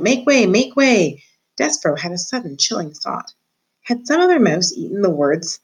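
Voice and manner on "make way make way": high, slow calling out. A narrator's reading. Threadmaster calling the crowd aside, slow and high. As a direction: announce it like a town crier. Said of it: "the threadmaster calling out".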